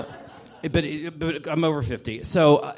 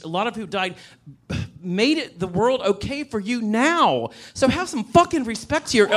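heated rant; 7 semitones higher than usual. Real, not acted. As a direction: demand respect, rising. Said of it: "That was a wonderful rant".

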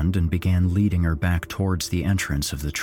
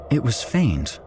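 plain reading voice

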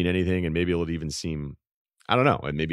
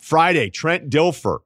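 show promo; 6 semitones higher, 6 dB louder than usual. Acted. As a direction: announce the guest like a promo.

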